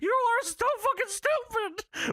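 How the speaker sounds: put-on high, strained old-man squeak; hammed-up high Paul Bearer whine; squeaky Paul Bearer voice. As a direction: squeal it like a pathetic old ghoul. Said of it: "Paul Bearer says".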